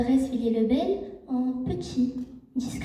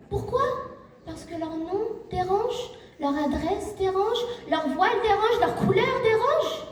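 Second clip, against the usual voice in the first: higher-pitched indignant list, climbing on each item; indignant oratory; 6 semitones higher than usual. Real, not acted. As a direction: hammer out the repeated grievance, rising. (indigné, ton qui monte à chaque reprise)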